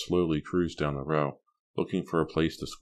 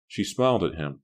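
ordinary delivery, nothing marked

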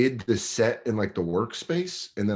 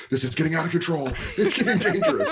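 mock panic; 7 semitones higher, faster than usual. Panicking as dirty talk escalates.

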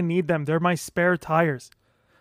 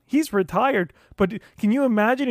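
usual speaking voice

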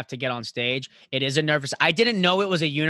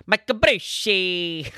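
hype announcer call; 4 semitones higher, much slower than usual. Acted. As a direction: call the name like a ring announcer. Hyped repeat of guest's name.

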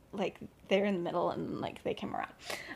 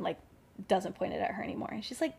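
usual speaking voice

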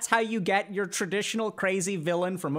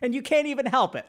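excited; 7 semitones higher, 4 dB louder than usual. Shown as a demonstration. Excited demo of mirroring.

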